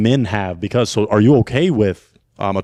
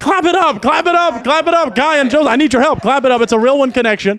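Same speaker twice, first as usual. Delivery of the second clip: hype host; 8 semitones higher than usual. Acted. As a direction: pump up the crowd like an MC closing the show. Host wraps up the show.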